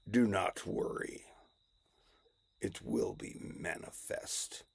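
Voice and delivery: low, hushed, measured villain voice; Darth Vader. Acted. Vader reassures her on the wobbly stairs, low and solemn. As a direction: speak low and solemn, each word deliberate. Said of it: "you know, Darth Vader is".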